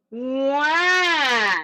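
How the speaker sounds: put-on high wailing baby cry; baby cry wah. Shown as a demonstration. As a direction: wail it out like a baby. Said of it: "the baby cry".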